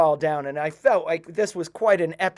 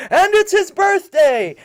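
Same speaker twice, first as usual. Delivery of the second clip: much higher, louder, slower festive shout; group cheer; 13 semitones higher, 8 dB louder, slower than usual. Retold or imitated. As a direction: shout it out like a crowd celebrating. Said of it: "all the rebbes under the tent say".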